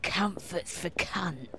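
low, soft and slow, lightly voiced old Soho man; quiet slow quote. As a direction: murmur it like a weary motto. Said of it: "He's like".

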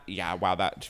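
ordinary talk

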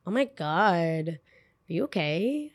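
slow, soft, sarcastic are you okay; hushed, slow mock pity; mock concern. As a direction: fake sympathy drawn out quietly.

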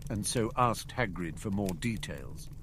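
plain reading voice

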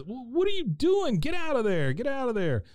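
high-pitched scolding shout; alarmed Hank. Retold or imitated. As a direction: yank them out of trouble, voice jumping way up. Hank comes up and he goes.

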